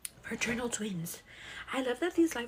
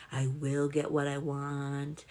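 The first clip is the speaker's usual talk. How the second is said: slow mantra; 7 semitones lower, slower than usual. Pretend. Mantra voiced slow and low.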